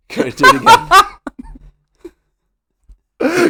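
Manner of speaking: high laughing plea. Real, not acted. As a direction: squeal it through laughter. Laughing asks for retake.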